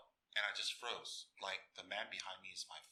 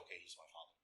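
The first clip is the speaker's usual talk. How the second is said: hushed inner acceptance; 4 semitones lower, 11 dB quieter, faster than usual. Retold or imitated.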